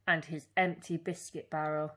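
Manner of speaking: ordinary delivery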